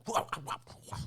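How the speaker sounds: high, breathy wah cries; mimicking a movie fight, lightly; mock karate cries. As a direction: imitate her fighting yells. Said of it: "she does this".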